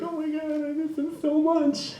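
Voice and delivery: put-on high, soft whiny girl voice; heartbroken friend. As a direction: pine for the ex. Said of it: "she's like".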